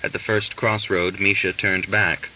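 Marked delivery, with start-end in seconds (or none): none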